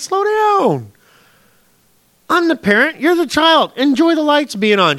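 high, drawn-out plea to calm down; acted parent's firm reassurance; exasperated parent. Voicing God calming a worried child.

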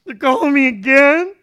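high, loud, hammed-up exasperated complaint; irritated at God. Preacher mimicking a bill-stressed believer praying, irritated and loud. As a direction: whine loudly at God in mock outrage. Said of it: "all irritated at God and saying".

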